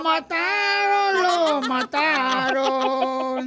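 drawn-out, hammed-up wail; mock lament. Crew reacting to a roast, mock lament. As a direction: stretch each repeat longer, like a mourner.

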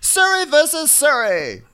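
high, slow theatrical voice; hammed-up haughty title reveal; grand movie title. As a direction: announce it with drawn-out mock grandeur. Joke movie titles in Karen's voice.

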